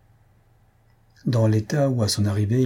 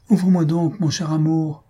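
higher, urgent plea; insisting; 6 semitones higher than usual. A narrator's reading. A young man insisting through the door that his love let him in. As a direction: press her to open the door. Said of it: "insista le jeune homme".

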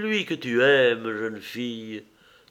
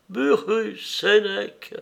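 higher-pitched, bitter echo of the names; 7 semitones higher, slower than usual. A narrator's reading. Unknown woman echoes Acté's two names, bitterly. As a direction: throw both names back with bitterness. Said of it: "reprit avec amertume".

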